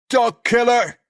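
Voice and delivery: very high, loud screaming curse; furious shout. Acted. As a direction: scream it at him in rage. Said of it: "He's so upset he turns on Sid".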